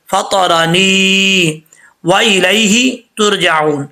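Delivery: फातारानी व इलैहि तुरजाऊन recited slowly; slow recitation. Shown as a demonstration. Teacher reciting the verse slowly for students. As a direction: recite each syllable deliberately. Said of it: "recitation demo".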